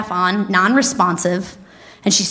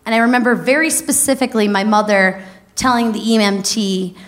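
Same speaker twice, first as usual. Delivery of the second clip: normal talking voice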